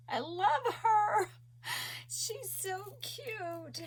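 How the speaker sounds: tearful, high crying voice. Real, not acted. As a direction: speak through real tears of joy. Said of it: "Sorry, guys, for bawling".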